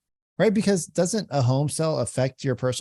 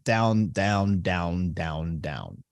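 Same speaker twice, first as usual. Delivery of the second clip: each down lower and softer; going down; 7 semitones lower, 3 dB quieter, much slower than usual. Shown as a demonstration. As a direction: let every down sink lower and quieter. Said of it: "it just goes".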